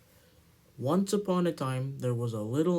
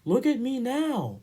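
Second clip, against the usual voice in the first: 1 proud little engine; 10 semitones higher, 4 dB louder than usual. Acted. Edward proudly puffs away.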